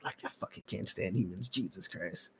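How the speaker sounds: very soft, low grumble; fed-up muttering. Real, not acted. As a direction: mutter it to yourself, worn out with people. Spent after the music rant.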